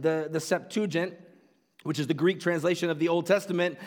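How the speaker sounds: ordinary talk, nothing marked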